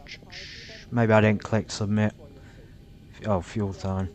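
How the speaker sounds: ordinary talk, nothing marked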